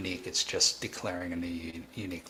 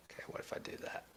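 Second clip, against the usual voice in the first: barely voiced whisper to test the mic; 15 dB quieter, much faster than usual. Real, not acted. Streamer testing his mic for viewers, whispering. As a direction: drop to a breathy whisper, testing the levels.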